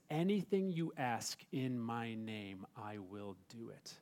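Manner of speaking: slow and soft, each word weighed; slow, emphatic reading. Shown as a demonstration. Pastor repeating Jesus' promise for emphasis, slow and hushed. Wanting to put some emphasis on it.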